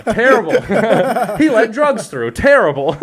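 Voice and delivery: cracking up at his own joke; laughing. Real, not acted. Mocking the border guard to his friend, laughing.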